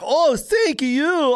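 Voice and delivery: high, very drawn-out gushing thanks; hammed-up slow, high-pitched thank-you. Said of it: "you think I'm gonna be like".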